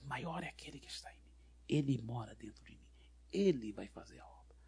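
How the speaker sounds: very soft, private murmur; silent inner declaration. Retold or imitated. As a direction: speak it under your breath as a secret confession of faith. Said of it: "não falei nada em voz alta, mas só aqui dentro".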